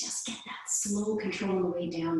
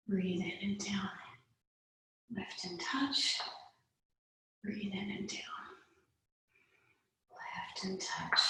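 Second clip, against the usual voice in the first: soft whispered cues; 10 dB quieter than usual. Real, not acted.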